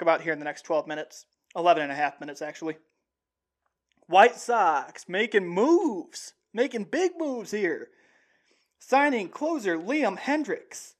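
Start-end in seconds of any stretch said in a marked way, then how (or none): 4.1-10.9 slow, stretched sing-song headline read; hyped headline announcement. Real, not acted. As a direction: draw out the big news with swooping pitch. Host announces big signing headline.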